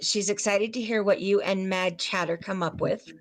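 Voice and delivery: ordinary delivery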